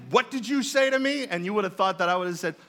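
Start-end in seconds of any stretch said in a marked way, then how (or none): 0.1-1.3 retold angry challenge.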